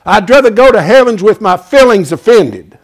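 loud, driving preacher's cadence; preaching at full force. Acted. Preacher warning the congregation about hell, loud and fervent. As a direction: hammer it home from the pulpit. Preacher warns against offending.

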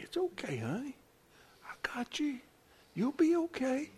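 very soft, soothing parent; tender comforting. Acted. Parent holding a crying child with a boo-boo, soft and comforting. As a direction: comfort a hurt child, almost whispering. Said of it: "you just hold 'em and you say".

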